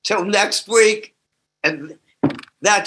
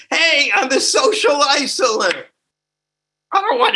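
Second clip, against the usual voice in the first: higher, laughing mock declaration; mock proud shout; 9 semitones higher than usual. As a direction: proudly announce it as a joke. Mock announcing own isolation.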